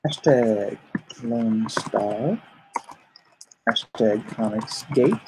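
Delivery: ordinary talk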